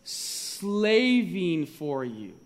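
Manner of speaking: "Slaving" drawn out very slowly and weighty; stress on slaving. Shown as a demonstration. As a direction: lean hard and slow on "Slaving for you". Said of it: "what's that next word there".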